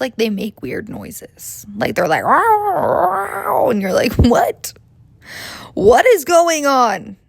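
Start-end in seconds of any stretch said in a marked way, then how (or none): 2.2-3.7 high, whiny sleep-talk wail; imitated sleep noise. Mocking a boyfriend's noises in his sleep, high whimpering wail. 4.2-7.2 mock alarm. Woken by boyfriend's noises.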